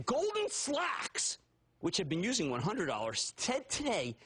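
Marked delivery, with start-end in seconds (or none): 0.0-1.4 mocking name. Real, not acted. Mocking rival bank name.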